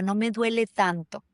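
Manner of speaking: normal talking voice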